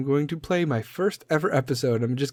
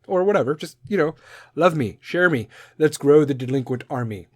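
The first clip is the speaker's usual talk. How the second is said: ordinary talk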